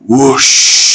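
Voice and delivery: a steamy whoosh of relief; steam whoosh. Acted. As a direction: blow it out like escaping steam. Engine lets off steam.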